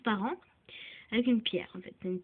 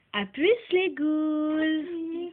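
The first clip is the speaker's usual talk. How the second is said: slow, stretched, higher goodbye to the ghouls; a playful doll waving goodbye, drawn out and up; cousin doll voice; 5 semitones higher, 6 dB louder, much slower than usual. Pretend. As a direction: drag out the farewell as the little cousin doll. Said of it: "c'est ma cousine qui vous dit à plus".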